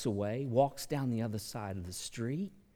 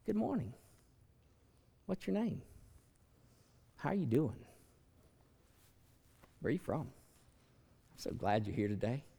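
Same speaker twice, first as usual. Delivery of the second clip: mock friendly greeting; 4 dB quieter than usual. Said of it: "what did it cost me to say".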